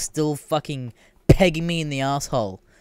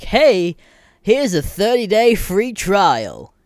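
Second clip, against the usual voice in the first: higher, louder, slow, sing-song pitch; mock salesy website; 8 semitones higher, 6 dB louder, much slower than usual. Mocking trial websites, high salesy sing-song. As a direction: sell the free trial like a cheesy ad. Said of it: "websites where it's like".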